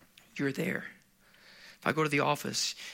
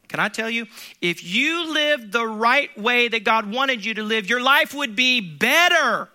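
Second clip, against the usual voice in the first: louder, slow, building preacher's cry; preaching at full force; 6 semitones higher, 7 dB louder, slower than usual. Acted. Preacher urging his congregation, building to a loud slow cry. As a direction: press the point home to the congregation.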